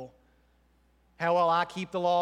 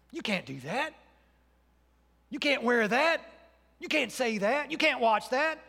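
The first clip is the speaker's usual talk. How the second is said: higher-pitched rule-maker's nagging; mock scolding; 6 semitones higher than usual. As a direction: imitate a legalist ticking off forbidden things. Voicing legalistic church rule-making.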